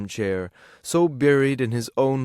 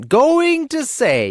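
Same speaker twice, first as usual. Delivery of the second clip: drawn-out, very high sneering repeat; wife parroting her husband in mockery; mocking echo; 8 dB louder, much slower than usual. A narrator's reading. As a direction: mimic him with scorn. Wife mocks his words.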